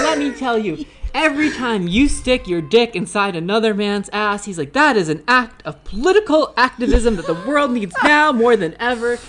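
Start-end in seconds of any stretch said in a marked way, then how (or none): 0.0-4.4 eager, laughing preachy enthusiasm, higher; imitating a thrilled historian's pep talk; excited. Historian cheering on the porn stars, excited. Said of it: "he was just so excited". 4.7-9.2 eager, laughing preachy enthusiasm, higher; imitating a thrilled historian's pep talk; excited. Historian cheering on the porn stars, excited. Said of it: "he was just so excited".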